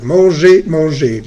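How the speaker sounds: "Manger, manger" both ending in a clean "ay"; pronounced the same. Shown as a demonstration. Teacher showing both spellings sound alike, silent R in "Manger, manger". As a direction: say "Manger, manger" identically, final R silent. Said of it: "se pronuncia igual".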